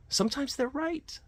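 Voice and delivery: squeaky high repeat. Shown as a demonstration. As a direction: squeak out "Sometimes they're right" as a silly echo.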